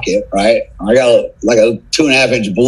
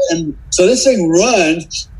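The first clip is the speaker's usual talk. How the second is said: ordinary delivery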